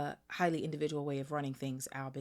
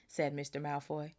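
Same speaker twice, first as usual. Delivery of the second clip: ordinary delivery, nothing marked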